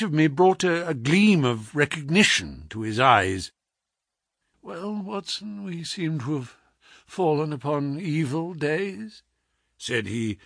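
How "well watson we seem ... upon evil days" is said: feeble voice. A narrator's reading. Sick detective greeting his friend from the bed, feeble and soft. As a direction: let the words barely come out of a sick man. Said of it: "in a feeble voice".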